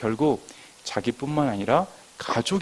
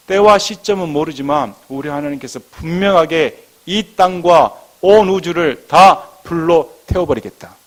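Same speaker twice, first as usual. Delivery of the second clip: loud, raised warning from the pulpit; forceful preaching; 6 semitones higher, 11 dB louder than usual. Real, not acted. Pastor warning that God will burn the world, preaching forcefully. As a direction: proclaim the judgment with full weight. Proclaims God's coming judgment.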